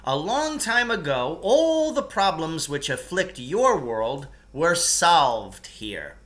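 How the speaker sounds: ordinary delivery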